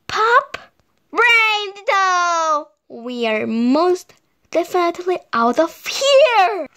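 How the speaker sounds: flee in alarm; panicked. A narrator's reading. Wolves panicking, fleeing.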